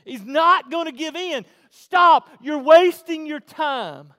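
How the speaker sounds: high, very loud, exasperated preacher; shouted, high-pitched pulpit exasperation; exasperated preaching shout. Acted. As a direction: preach it at full force, pleading with someone to stop. Preaches at full force.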